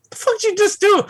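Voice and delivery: can't believe what he just did; incredulous. Retold or imitated. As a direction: jump high, stunned disbelief. Incredulous reaction to prank call.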